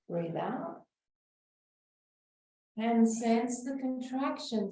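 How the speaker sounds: slow, soothing breath-work guidance; guided breathing. Acted. Teacher guiding a breathing class, calm and slow. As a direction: talk the group calmly through each breath.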